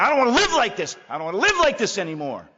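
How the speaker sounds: loud, high desperate wail; a man at the end of his rope crying out; anguished cry. Retold or imitated.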